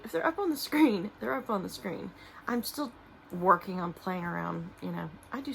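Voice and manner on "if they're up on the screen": higher and louder, breezy whatever-happens tone; emphatic shrug. Real, not acted. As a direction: toss it off playfully, pitched up and punched out. Shrugging off uncertain screen edits.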